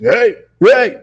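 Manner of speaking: retold heckler's yell, building in pitch; imitated screaming. Man screaming from behind at the dog walker, imitated screaming.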